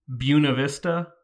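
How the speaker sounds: Buena Vista said the local Virginia way; Virginia pronunciation. Shown as a demonstration. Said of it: "pronounced, uh, very incorrect".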